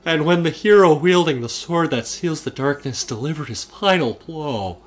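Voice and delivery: high, loud, slowing dramatic narrator, drifting Irish; Patrick Warburton voice. Pretend. Imitating Patrick Warburton for a friend, loud and slowing at the end. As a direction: read it like a grand narrator, slowing on final blow. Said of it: "You're sounding Irish".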